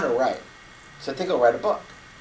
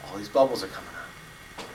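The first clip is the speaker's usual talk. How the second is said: ordinary talk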